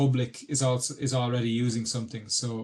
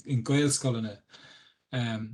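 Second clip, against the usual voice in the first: ordinary talk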